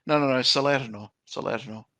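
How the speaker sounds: usual speaking voice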